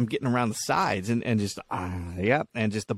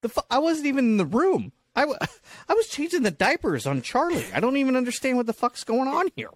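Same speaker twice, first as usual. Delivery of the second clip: outraged husband; 13 semitones higher than usual. Retold or imitated. Accused husband protesting to the police, high indignant yelp. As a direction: squeal your innocence to the cops. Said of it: "He's like".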